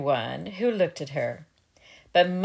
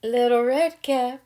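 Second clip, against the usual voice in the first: put-on wolf faking a higher girlish voice; wolf posing as girl; 5 semitones higher than usual. A narrator's reading. Wolf at the door imitating Little Red Cap's voice. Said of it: "the wolf replied".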